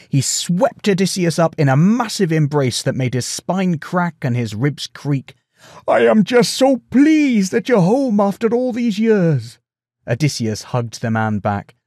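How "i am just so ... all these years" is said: high, loud, gushing delight; overjoyed. A narrator's reading. Farmer hugs his long-lost king, overjoyed. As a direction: pour it out high and loud with joy. Joyful embrace of returned friend.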